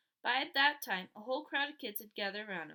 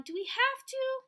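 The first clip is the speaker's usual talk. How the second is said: whiny complaint; 14 semitones higher, 6 dB louder than usual. A narrator's reading. Boy whines at mom.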